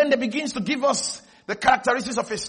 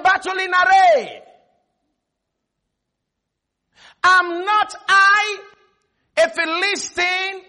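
high, loud, drawn-out challenge; Goliath's battle shout; 10 semitones higher, 7 dB louder, much slower than usual. Acted. Goliath challenging the armies of Israel, high slow shouting. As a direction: call it slowly across to an army. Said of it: "He shouted unto the armies".

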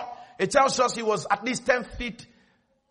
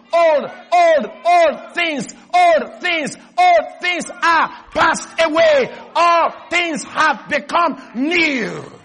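high, loud, slow scripture proclamation; full-force preaching; 8 semitones higher, 8 dB louder, much slower than usual. Real, not acted. Preacher proclaiming that old things are passed away, full-force preaching. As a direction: ring out each phrase with drawn-out force.

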